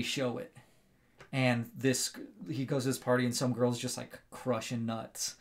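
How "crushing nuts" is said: ordinary delivery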